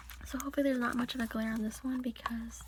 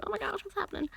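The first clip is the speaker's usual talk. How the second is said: lightly voiced alarmed animals, higher pitch; a hint of panicked barnyard animals; mock startled farm animals; 8 semitones higher, faster than usual. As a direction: play the cow and chickens in a fluster. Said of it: "The cow and the chickens are like".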